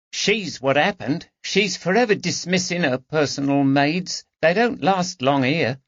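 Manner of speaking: a touch of eye-rolling housemaid; a maid tutting about her mistress; lightly voiced maid Sarah. A narrator's reading.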